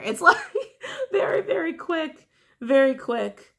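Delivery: high-pitched, laughing through the words; amused laughing. Real, not acted. Reader amused by how quick the escape was, laughing. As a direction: let the laugh lift your voice right up. Laughs after acting out the panic.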